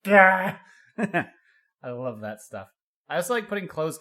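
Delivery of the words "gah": Terry Crews style shouted gah; mock yell. Friend imitating Terry Crews yelling, loud gah. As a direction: bark out a yelling face, loud. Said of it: "His face is so, like".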